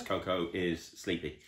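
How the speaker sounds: soft hushed aside. Real, not acted. Soft aside about sleeping dog.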